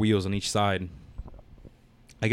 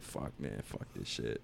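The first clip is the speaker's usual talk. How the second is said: hushed, laughing curse, much lower and softer; muttered curse under breath; 13 semitones lower, 18 dB quieter, slower than usual. Retold or imitated. Lying hurt on the road, cursing to himself, low and breathless. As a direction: grumble it half-laughing to yourself. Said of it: "I'm just sitting there like".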